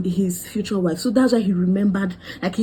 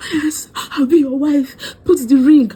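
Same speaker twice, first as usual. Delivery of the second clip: mock swooning reply; 6 semitones higher, 5 dB louder than usual. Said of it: "Am I supposed to say".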